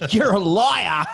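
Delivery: high, loud mock-outraged yell; jokey shrieked accusation. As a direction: shout it like an indignant heckler. Imagined fact-checkers accusing the host.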